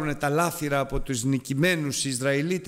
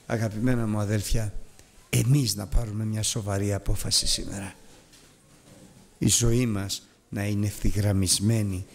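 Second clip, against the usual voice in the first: normal talking voice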